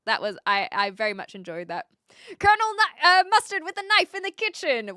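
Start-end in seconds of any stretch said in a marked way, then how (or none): 2.4-4.9 high, loud Cluedo guess; higher, louder mock game call; mock accusation. Real, not acted. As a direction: announce the verdict grandly.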